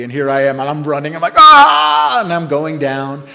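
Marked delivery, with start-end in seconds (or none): 1.4-2.2 long, high, loud charging yell; battle cry. Retold or imitated.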